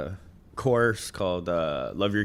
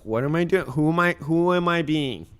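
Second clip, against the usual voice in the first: louder, higher alarmed self-check; self-questioning; 6 semitones higher, 5 dB louder, faster than usual. Retold or imitated. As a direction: catch yourself mid-mistake. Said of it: "I have to step up and go".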